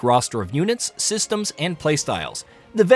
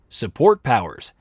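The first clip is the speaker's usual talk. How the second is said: top ten countdown; 3 semitones lower than usual. Acted. List item heading.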